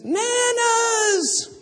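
put-on high squealing toddler voice; toddler's cry. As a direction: squeal it like a thrilled little girl. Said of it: "She says".

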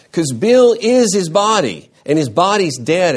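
forceful pulpit emphasis; emphatic preaching. Real, not acted. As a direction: drive the point home, loud. Emphatic sermon point.

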